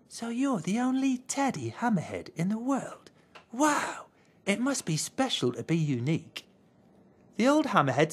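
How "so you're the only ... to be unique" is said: soft, higher small-bear voice; a puzzled little bear; a shy little bear, puzzled by the hammer; little teddy bear. A narrator's reading. Green speaks in wonder.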